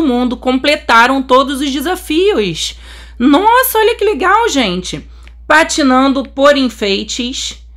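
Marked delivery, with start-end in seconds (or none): 3.2-4.5 high, thrilled exclamation; excited amazement. Real, not acted. Player shows viewers the global event, amazed.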